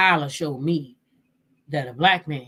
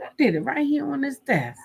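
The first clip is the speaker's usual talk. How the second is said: mimicking mistress; 8 semitones higher than usual. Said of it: "She said".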